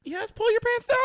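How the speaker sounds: high, loud granny voice; a grandma calling him in; foul mouthed granny. Acted. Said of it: "my grandma voice is not good".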